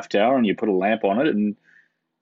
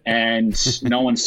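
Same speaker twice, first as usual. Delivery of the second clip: slow, spaced-out delivery as if to someone who can't follow; very slow speech; 2 semitones higher, slower than usual. Retold or imitated. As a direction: imitate someone speaking very slowly. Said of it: "he spoke very slowly".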